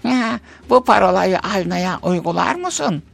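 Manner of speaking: high, put-on voice of a stingy old aunt; old miser woman voice. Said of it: "demiş". (yaşlı cimri kadın sesi)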